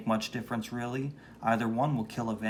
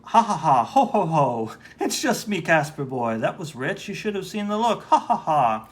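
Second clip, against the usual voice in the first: mocking laugh; 4 semitones higher, 7 dB louder than usual. Prankster laughing at Casper.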